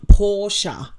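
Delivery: Porsche drawn out as "POR-shuh"; two-syllable Porsche. Shown as a demonstration. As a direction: say Porsche slowly with a final "uh". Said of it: "not Porsche".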